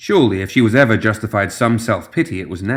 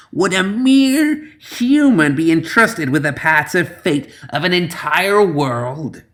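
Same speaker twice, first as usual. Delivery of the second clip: put-on high, slow, spitting old crone; spat hag voice; 7 semitones higher, slower than usual. A narrator's reading. Old hag spitting back at the slumped man. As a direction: spit the words like an ancient hag. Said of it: "she spat".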